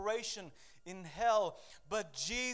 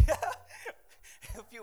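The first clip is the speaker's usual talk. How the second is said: laughing; 5 dB louder, much faster than usual. Real, not acted. Laughing at Discman memory.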